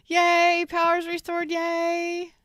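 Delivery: squealing happily that the power is back; cheering. Real, not acted. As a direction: celebrate the lights coming back on. Power came back during stream.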